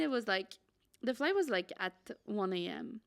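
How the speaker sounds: ordinary delivery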